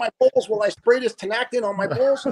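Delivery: high, put-on innocent voice; mock request to Larry. Gibbo teasing Larry in the bunk room, high mock innocence. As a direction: play the prankster asking a gross favor. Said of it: "all of a sudden he goes".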